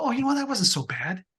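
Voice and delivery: high, light relieved voice; imitating someone relieved after a hurdle; mock relieved surprise. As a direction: play pleasantly surprised it was easy. Voicing relief past barrier.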